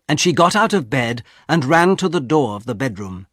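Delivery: ordinary delivery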